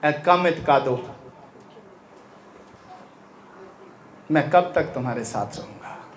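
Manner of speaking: low, quiet and slow, like a weary sigh; hushed lament; soft, slow quoting. Retold or imitated. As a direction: say it softly, almost to himself. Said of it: "Jesus ने कहा".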